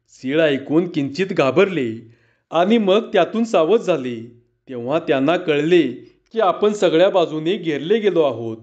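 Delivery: ordinary delivery, nothing marked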